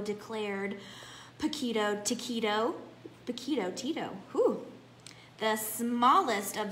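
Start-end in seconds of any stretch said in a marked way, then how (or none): none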